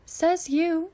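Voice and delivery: a touch of annoyed retort, loud and high; mildly annoyed comeback. A narrator's reading. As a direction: scoff it back, irritated. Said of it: "sounding a bit annoyed".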